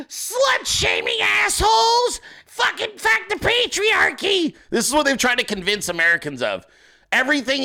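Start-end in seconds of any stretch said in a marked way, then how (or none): 0.1-4.5 mocking screeching activists, high and slow; mock outraged shriek. Comedian mocking activists in a high shriek. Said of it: "they'd be like".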